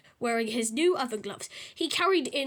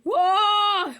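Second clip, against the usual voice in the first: long, louder, higher wail; 7 semitones higher, 8 dB louder than usual. A narrator's reading. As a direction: howl as if hurt. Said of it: "wailed Peter".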